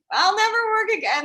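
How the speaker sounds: high, fast mock panic; hammed-up actor panic, pitched high. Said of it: "that actor panic".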